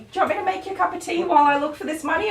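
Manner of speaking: high, rushed mock offer of tea; lonely client impression. Groomer mimics client delaying her payment, mock eager. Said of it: "yeah, she's like".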